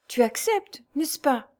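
high, imploring question; almost pleading. A narrator's reading. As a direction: coax with a near-begging lift in the voice. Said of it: "avec une voix presque suppliante".

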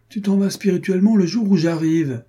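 mock reproach. [name] teases his friend for leaving, loudly ironic.

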